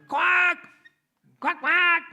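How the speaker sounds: very high, loud duck squawk; mother duck quacking. Retold or imitated. Mother duck offering bread to ducklings, loud quacking.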